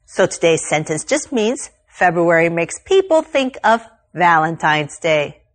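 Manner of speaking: ordinary delivery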